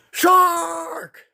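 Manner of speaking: "Shark!" thrown up very high in mock terror; imitating a boy's high frightened scream of "Shark!"; imitated panicked yell. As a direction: cry "Shark!" like someone is about to die. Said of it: "The way he yells "shark" is how you hear somebody yell".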